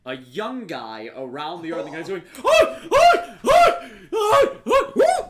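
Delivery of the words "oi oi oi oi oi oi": very high, loud cries of alarm; shrill repeated shouts, acted in full; panicked yelps. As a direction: yelp like you're being chased by a dog. Chased man crying out in panic.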